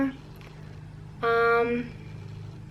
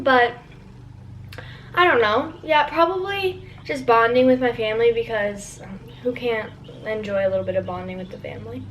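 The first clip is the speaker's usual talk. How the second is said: ordinary talk, nothing marked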